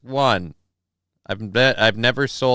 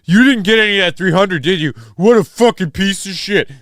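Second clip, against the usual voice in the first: higher, louder put-on voice of an indignant viewer; mock outraged viewer; 6 semitones higher, 5 dB louder than usual. Streamer imagining a viewer calling him out, mock outrage. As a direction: sneer it like a furious fan. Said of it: "I wanna see somebody be like".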